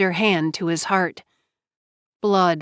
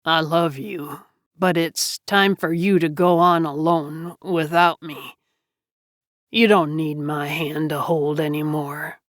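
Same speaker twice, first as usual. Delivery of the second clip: dying Jonah, low and weak; 3 semitones lower than usual. Acted. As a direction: speak low, fading with life. Dying man's farewell.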